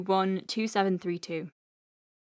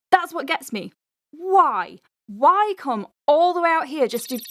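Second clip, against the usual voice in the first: exasperated outburst; 7 semitones higher, 5 dB louder than usual. Acted. Exasperated rant about the patient.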